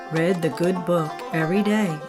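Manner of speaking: ordinary delivery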